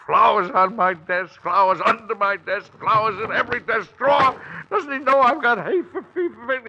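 a president losing his temper over hay fever; irritated outburst. Acted. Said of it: "He was annoyed, irritated, and uncomfortable".

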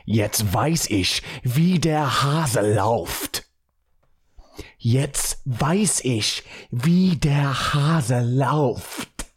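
very slow, drawn-out German; slow German phrase reading. Shown as a demonstration. Host reading a common German phrase to listeners, mock-calm and slow. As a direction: stretch each German word deliberately. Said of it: "casually and calmly read very common German phrases".

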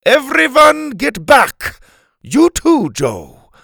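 high, loud crowd-clearing order; commanding shout. Acted. Helga orders the kids away from Doctor Rex, commanding. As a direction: take charge and shout them back.

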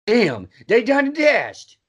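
drawn-out comic exclamation; puppet character imitation. Mimicking Daniel's puppet show line, exaggerated exclamation.

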